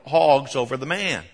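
ordinary delivery

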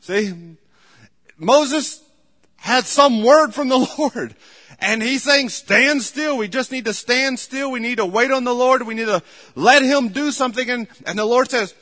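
loud, high, driving pulpit preaching; preaching at full force. Acted. Pastor exhorting his church, preaching at full force. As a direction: hammer the point home to the congregation. Fiery sermon on waiting.

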